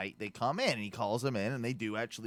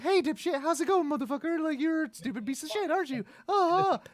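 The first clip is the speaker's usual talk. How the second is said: high-pitched gushing dog talk; sweet pet-talk tone; 3 dB louder than usual. Acted. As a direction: coo insults as if praising a dog. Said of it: "going to the tone of the voice".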